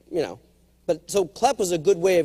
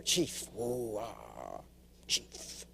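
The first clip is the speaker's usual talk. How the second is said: whispered, much softer chief; hushed reverent chief; 9 semitones lower, 16 dB quieter, much slower than usual. Pretend. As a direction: breathe it like a holy word. Savouring the dream promotion.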